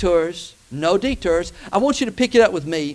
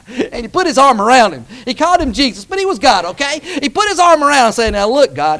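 louder, higher incredulous retelling; animated preaching; 6 semitones higher, 9 dB louder than usual. Real, not acted. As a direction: punch out the story in a raised preacher's voice. Preacher retells Peter's presumption.

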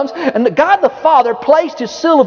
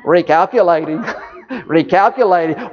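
lightly mimicked sat-nav voice, a touch lower; GPS voice; 6 semitones lower than usual. Preacher mocking the GPS lady, lightly imitated. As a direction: drone it like the car's navigation voice. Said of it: "have you ever heard that voice say".

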